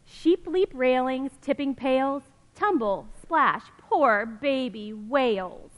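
lively storybook reading voice; reading to children. Acted. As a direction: read it like a picture book for kids. Reading picture book aloud.

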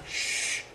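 soft drawn-out shush; hushing "shh". Retold or imitated. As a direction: imitate a secretive shush. Hushing in retold secretive chat.

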